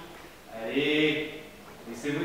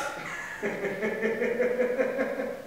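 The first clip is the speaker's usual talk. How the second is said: mocking laugh; 7 semitones higher than usual. Devil mocks the vow.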